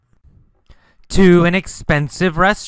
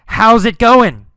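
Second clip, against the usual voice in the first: raised, high calling greeting; yelled; 6 semitones higher, 5 dB louder than usual. Acted. Teacher repeating how Carlos called, yelled. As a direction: call it out loudly. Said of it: "Carlos yelled".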